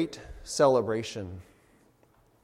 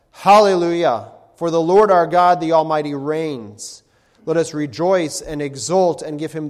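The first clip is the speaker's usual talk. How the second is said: crying out; 9 dB louder than usual. Acted. Preacher voices the roaring multitude in heaven, loud praise. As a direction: thunder it out like many waters. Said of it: "crying out".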